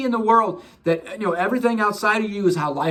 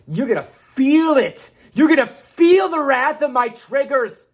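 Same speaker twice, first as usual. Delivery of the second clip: mock outraged canceler. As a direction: play a self-righteous person threatening to cancel someone. Mock offended person threatening cancel.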